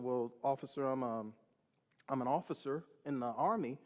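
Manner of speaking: subdued, deferential explanation; meek reply. Retold or imitated. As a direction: answer the officer carefully, haltingly. Nervous polite answer to policeman.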